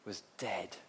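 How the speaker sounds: normal talking voice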